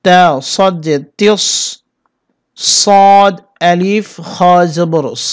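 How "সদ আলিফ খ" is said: সদ আলিফ খ with the আ stretched very long; সদ আলিফ খ drawn out four beats; long drawn vowel. Shown as a demonstration. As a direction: hold the আলিফ for four counts. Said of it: "টেনে গিয়ে চার আলিফ টেনে".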